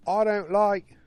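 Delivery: high, slow mock British lady voice; hammy British wife accent. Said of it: "I've changed to more of a Middle Eastern accent".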